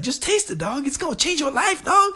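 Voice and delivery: very high buddy voice pushing food; high, excited imitation of a friend. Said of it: "And he's like".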